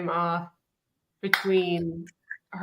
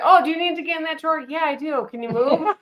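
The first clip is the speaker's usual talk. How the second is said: higher-pitched, quickly started kitchen squabble; mock impatience; 8 semitones higher, 5 dB louder, faster than usual. Woman retelling being crowded in the kitchen, mock impatient. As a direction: act out polite asking turning pointed.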